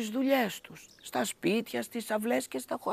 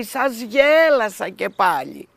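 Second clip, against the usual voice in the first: mocking; 10 dB louder than usual. A narrator's reading. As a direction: rub it in, laughing at them. Shepherd mocks villagers again.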